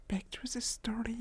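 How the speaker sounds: unvoiced whisper to herself. Real, not acted. Nudging the chat back to the story in a whisper. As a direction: breathe it out as a whispered aside. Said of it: "whispered aside".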